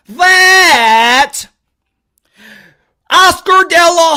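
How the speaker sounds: drawn-out, word-by-word emphatic delivery; exaggeratedly slow. Shown as a demonstration. Mocking critics who say he talks too fast, overly slow and emphatic. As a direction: spell it out slowly for slow listeners. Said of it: "Let me slow down".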